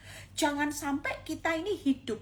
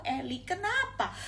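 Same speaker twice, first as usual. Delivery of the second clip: ordinary delivery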